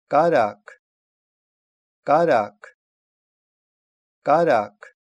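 teacher's emphatic "Карак", k after the vowel; demonstrated pronunciation. Shown as a demonstration. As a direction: model "Карак" firmly, ending on a released k. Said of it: "и после гласных звуков".

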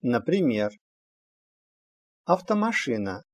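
normal talking voice

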